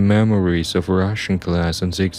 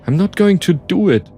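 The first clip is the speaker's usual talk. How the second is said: high-pitched, mounting protest; desperate refusal; 7 semitones higher, 4 dB louder than usual. Acted. Narrator with gun on the driver, desperate. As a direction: refuse with panicked insistence.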